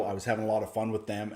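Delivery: normal talking voice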